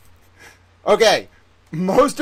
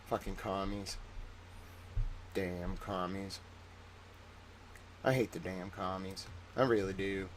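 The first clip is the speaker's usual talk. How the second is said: very low, much softer grumble; grumbling old patriot voice; 10 semitones lower, 20 dB quieter than usual. Pretend. Streamer grumbling to chat about commies, low and soft. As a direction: growl it under your breath like a cranky old man. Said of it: "Why am I talking this way".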